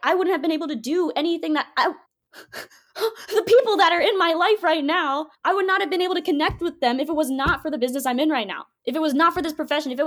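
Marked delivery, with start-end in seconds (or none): 2.9-6.6 tearful. Real, not acted. Emotional about people in her life.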